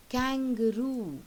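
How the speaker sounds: Kangaroo said slow and loud, beat by beat; teacher counting syllables aloud; sounding out syllables. Shown as a demonstration. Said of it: "How many do you have?".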